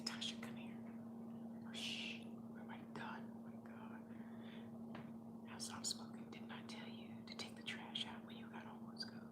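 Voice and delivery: hushed, scolding whisper; mother's angry whisper. Retold or imitated. Mother scolding daughter about the trash, in a whisper. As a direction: scold in a whisper so nobody hears. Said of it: "And she'd say".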